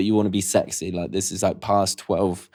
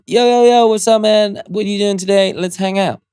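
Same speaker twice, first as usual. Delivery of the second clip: much higher and louder, hyped greeting; hyped voice note; 10 semitones higher, 4 dB louder than usual. Shown as a demonstration. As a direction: bounce in like a hyped-up mate. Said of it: "voice noting, hold it down".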